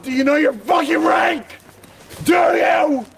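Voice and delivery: angry shout. Real, not acted. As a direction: shout it at the cop. Drunk man yelling at officer.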